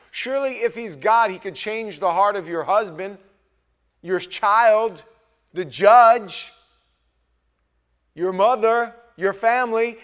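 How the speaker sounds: loud, high, rising sermon cry; impassioned pulpit build; full-force preaching. Acted. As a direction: preach it hard to the congregation.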